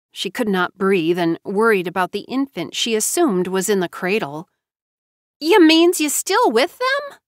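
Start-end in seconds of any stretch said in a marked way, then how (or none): 5.4-7.2 much higher, louder, startled question; surprised and a little mocking. A narrator's reading. Tamara hearing JoBeth stayed, surprised. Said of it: "Tamara sounded surprised".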